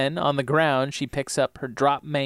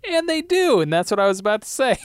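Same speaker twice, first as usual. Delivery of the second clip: high, loud, hurried protest; caught-out backpedaling; 9 semitones higher, 5 dB louder, faster than usual. Real, not acted. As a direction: squeal it quickly as you backpedal.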